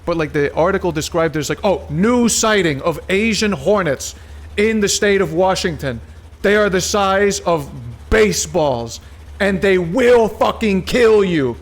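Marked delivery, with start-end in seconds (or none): 1.6-11.6 higher, louder dramatic news read; mock alarming news. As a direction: read it like a scary bulletin. Reads alarming article.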